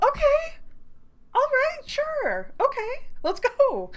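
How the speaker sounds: high, squeaky flustered okays; giddy high-pitched yes; flustered squeak. Real, not acted. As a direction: squeak out nervous agreement. Flustered when invited to walk.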